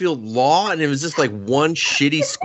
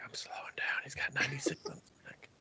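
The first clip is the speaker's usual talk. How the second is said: whispering; 18 dB quieter, faster than usual. Retold or imitated. Imagined driver sneaking past.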